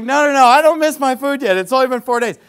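high, loud, amused refusal; polite but loud insistence; laughing protest. Retold or imitated. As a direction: brush it off with a laugh, loudly. Politely refusing fast food.

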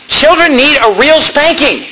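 usual speaking voice

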